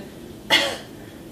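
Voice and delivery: a short single cough; demo cough. Shown as a demonstration. Therapist showing a cough to find belly muscles. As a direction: cough once to feel the belly. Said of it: "So just doing a".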